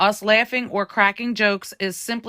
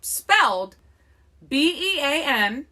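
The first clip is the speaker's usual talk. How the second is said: ordinary talk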